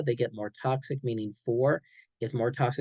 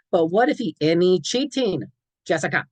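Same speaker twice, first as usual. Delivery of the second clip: higher, louder pleading protest; doubtful teen objection; 6 semitones higher, 6 dB louder than usual. Shown as a demonstration. Teen objects to the counselor's rule, high and pleading. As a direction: argue the point like a teen. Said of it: "and it'll be like".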